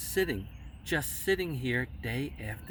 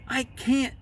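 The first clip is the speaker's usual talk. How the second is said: a higher, louder protest; firm refusal; 9 semitones higher, 4 dB louder than usual. A narrator's reading. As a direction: push back hard. Elephant refusing the bird.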